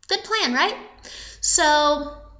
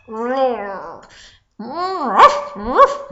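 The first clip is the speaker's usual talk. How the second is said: growls ending in short loud barks; growl, then ruff like a wolf; storyteller's animal noises; imitated wolf growl; 3 semitones lower, 4 dB louder, much slower than usual. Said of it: "heard a growl".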